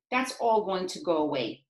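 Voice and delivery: ordinary talk, nothing marked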